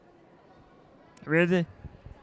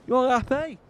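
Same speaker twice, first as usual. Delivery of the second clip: ordinary delivery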